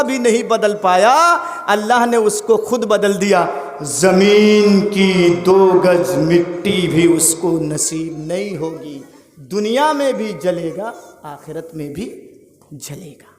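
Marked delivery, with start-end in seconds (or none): 3.8-13.3 solemn pronouncement. Real, not acted. Preacher pronounces doom on Quran changers.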